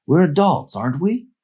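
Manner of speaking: lightly voiced woman; Amy's voice. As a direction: coax him teasingly. Amy's higher voice.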